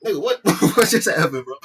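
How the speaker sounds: loud, high, cracking up in shock; mock-flabbergasted and loud; laughing disbelief. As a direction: blurt it out through laughter. Acting own shocked reaction, laughing.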